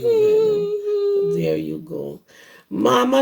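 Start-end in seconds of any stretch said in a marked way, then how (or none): 0.0-1.3 high crying whimper put on for the child; mock whimper. Reading aloud to children, a high mock whimper. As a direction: whimper it out in a high thin cry. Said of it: "she can't whimper for you".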